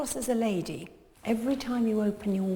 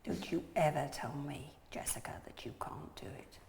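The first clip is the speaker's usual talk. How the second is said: whisper; 6 semitones lower, 14 dB quieter than usual. Real, not acted. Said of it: "whispered to pupil".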